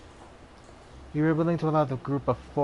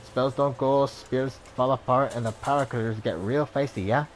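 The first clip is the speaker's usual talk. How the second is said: put-on Dublin accent. Ex-ranger tells a stranger her story, Dublin accent. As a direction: tell your past in a Dublin lilt. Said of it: "Born and raised in Dublin".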